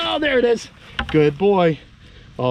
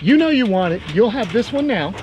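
ordinary talk

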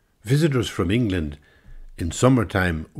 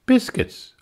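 much higher, flippant; tart reply; 5 dB louder than usual. Acted. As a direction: toss the word back at him. Woman answers Englishman.

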